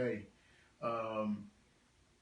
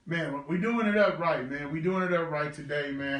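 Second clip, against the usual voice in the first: excited; 3 semitones higher, 7 dB louder than usual. Real, not acted. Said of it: "I'm a little emotional".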